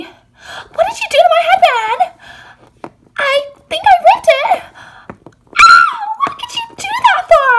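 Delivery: very high squealing wail; wailing dismay. Acted. Girl finds her headband ripped, shrill wailing. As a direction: wail it in horrified outrage.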